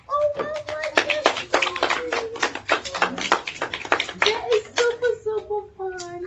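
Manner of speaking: high, loud cheer of praise; delighted. Real, not acted. Praising the story to children, high excited. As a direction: gush with delight.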